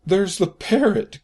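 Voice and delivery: high, loud, slow delighted cry; excited cry. A narrator's reading. Scrooge spotting the parrot, excited cry. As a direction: shout with childlike joy. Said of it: "cried Scrooge".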